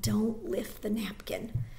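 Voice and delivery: slow breathy whisper; silent plea. Acted. Girl begging the soldier in her head, whispered. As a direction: whisper it like a prayer. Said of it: "she implored in her mind".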